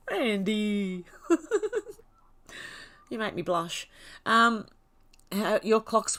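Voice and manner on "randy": high giggling laugh through the name; laughing bashfully at a flirt. Real, not acted. Streamer giggles at a flirty viewer, laughing.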